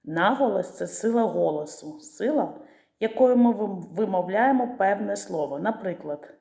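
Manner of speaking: ordinary talk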